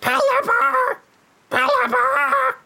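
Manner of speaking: high, drawn-out squawking bird cry; bird call. Pretend. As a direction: screech it like a seabird. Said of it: "Do your bird call".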